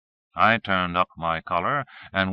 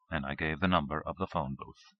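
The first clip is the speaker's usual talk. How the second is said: usual speaking voice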